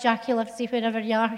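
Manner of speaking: ordinary talk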